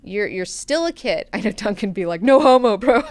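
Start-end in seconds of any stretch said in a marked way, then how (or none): 2.2-3.0 loud, higher bro-speak; mock bro retort. Duncan fending off Maneo's truth bomb, mock bro retort. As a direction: toss it out loud like a teasing bro.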